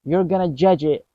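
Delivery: ordinary talk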